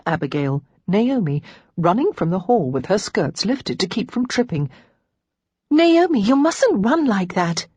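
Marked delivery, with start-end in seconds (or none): 5.7-7.7 high opening call, then slower; raised-pitch cry of concern; alarmed exclamation. A narrator's reading. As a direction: call out a worried warning. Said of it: "Lara exclaimed".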